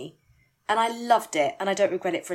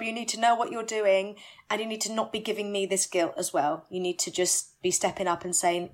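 ordinary delivery

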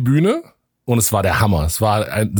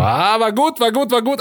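egging on crowd; 9 semitones higher than usual. Retold or imitated.

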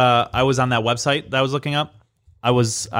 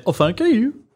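teasing, high put-on voice; Bradley; 10 semitones higher than usual. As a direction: stretch it out high in a silly voice. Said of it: "as Bradley would say".

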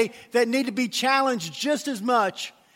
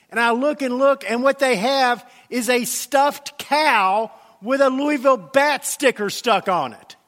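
ordinary delivery

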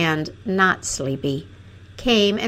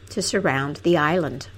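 plain reading voice

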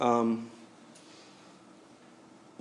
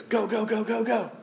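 high, hurried calls of go; urgent go signal; 8 semitones higher, 4 dB quieter than usual. Retold or imitated. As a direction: hurry them on with high calls. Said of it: "somebody goes".

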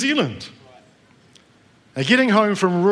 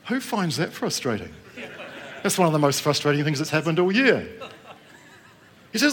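ordinary talk

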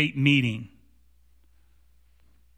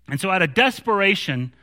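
ordinary talk, nothing marked

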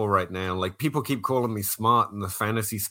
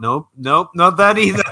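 loud, much higher, laughing-edge denial; mock denial; 8 semitones higher, 11 dB louder than usual. Englishman denies being smart to Americans, high loud mock denial. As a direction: shoot it down with a high comic cry.

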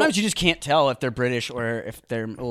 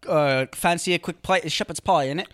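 mock British chatter, higher and louder; hammed-up British impression; British accent; 5 semitones higher, 3 dB louder than usual. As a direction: put on a cheeky British accent. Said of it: "people from the U K be like".

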